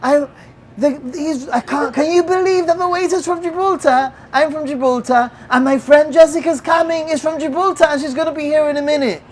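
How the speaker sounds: high, loud, stumbling over the words with excitement; excited. Retold or imitated. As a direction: re-enact gushing amazing news to strangers. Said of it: "I was really excited".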